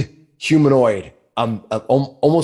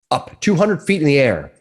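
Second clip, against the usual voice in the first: usual speaking voice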